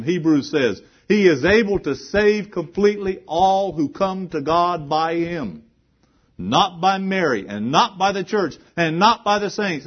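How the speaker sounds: high, loud pulpit proclamation; fiery sermon climax; full-force preaching. Acted. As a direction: hammer each line home.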